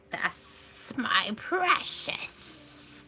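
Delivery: whispered, slow "that's my precious" with a hiss; creepy whispered Gollum. Pretend. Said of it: "say that with a lot of extra S".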